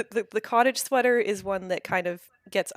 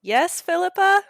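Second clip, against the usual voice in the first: distant call; 11 semitones higher, 9 dB louder than usual. Acted. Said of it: "a quiet voice calling back".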